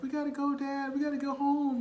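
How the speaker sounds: put-on high voice of a frightened boy; scared child. Frightened son begging his dad to go home, high. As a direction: plead like a scared kid.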